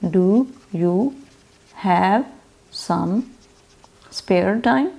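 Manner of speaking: "Do you have some spare time?" each word split by long pauses. Shown as a demonstration. Teacher to students, slow word-by-word model sentence. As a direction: spell it out slowly for the class. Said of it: "क्या कहेंगे?".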